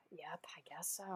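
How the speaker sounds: whispered, low concession after a sigh; sigh, then concede under your breath; barely audible, whispered agreement; hushed reply. Real, not acted.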